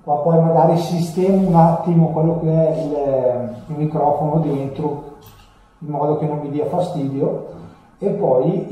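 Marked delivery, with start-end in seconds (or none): none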